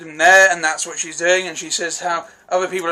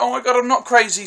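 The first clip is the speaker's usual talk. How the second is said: higher, delighted relief; relieved excitement; 7 semitones higher, faster than usual. Retold or imitated. Mark realising he is not crazy, imitated with excited relief. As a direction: burst out as if proven right at last. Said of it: "he's super happy that he's not crazy".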